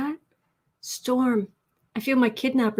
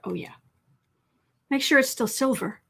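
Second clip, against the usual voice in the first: ordinary talk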